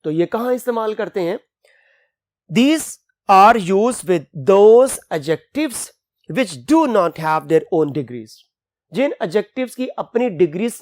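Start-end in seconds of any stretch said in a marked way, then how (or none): 2.5-8.4 slow, emphatic rule read-out; dictating a rule. Shown as a demonstration. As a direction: read the rule slowly for the class. Teacher reads rule slowly. (धीमे ज़ोर से नियम पढ़ना)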